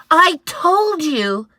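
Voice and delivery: put-on high child cry. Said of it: "cried Eddie".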